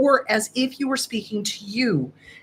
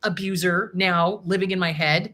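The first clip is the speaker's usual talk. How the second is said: normal talking voice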